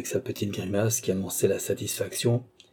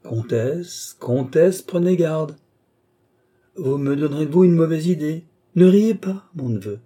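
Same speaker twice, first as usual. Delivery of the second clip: higher and louder, rising to a cry; mock alarmed warning; 7 semitones higher, 6 dB louder than usual. A narrator's reading. The old duke warns the laughing countess, hammed-up protest. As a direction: warn her off in hammed-up alarm.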